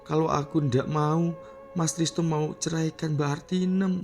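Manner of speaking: high-pitched plaintive pleading; tearful confession. A narrator's reading. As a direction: explain yourself through tears. Weeping girl pleads her confession.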